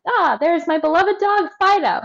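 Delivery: high, fond mock owner greeting the dog; lightly voiced happy owner; doting owner. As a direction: coo it like a proud pet parent. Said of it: "and goes".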